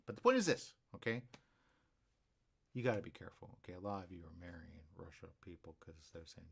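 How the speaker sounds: ordinary talk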